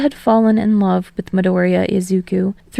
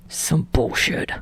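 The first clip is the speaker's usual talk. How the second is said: low, soft, breathy grumble; gruff mutter; 6 semitones lower, 8 dB quieter, slower than usual. A narrator's reading. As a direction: mutter it under your breath, annoyed. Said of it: "Bakugo mutters".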